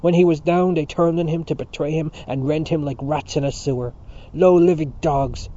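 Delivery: loud, impassioned denunciation; angry cry. A narrator's reading. Mister Dedalus on those who betrayed their leader, angry cry. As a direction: hurl the insults out, dragging the last one. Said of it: "cried Mister Dedalus".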